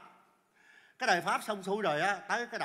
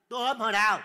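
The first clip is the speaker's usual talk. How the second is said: to tiếng chối phắt; bật lên phản đối thật to; cãi lại phẫn nộ; 2 semitones higher, 9 dB louder, faster than usual. Acted. Cãi lại lời buộc tội. (gắt lên chối tội)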